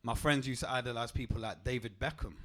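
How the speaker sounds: ordinary talk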